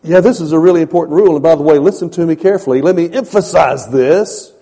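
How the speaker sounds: God urging. Retold or imitated. God warning Moses to listen, fast and loud. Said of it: "he's saying".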